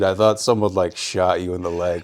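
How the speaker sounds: ordinary delivery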